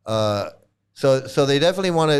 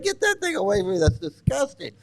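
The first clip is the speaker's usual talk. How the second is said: high, squeaky squeal of disgust; 10 semitones higher than usual. Retold or imitated. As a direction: shriek it away, grossed out. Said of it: "I'm like".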